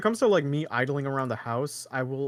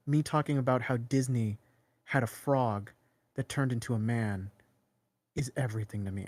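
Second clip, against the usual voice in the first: mock pretentious video essayist; 2 semitones lower than usual. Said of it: "I also hate the kind of video essay thing".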